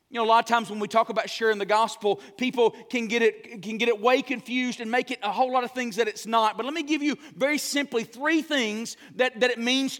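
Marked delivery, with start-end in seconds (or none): none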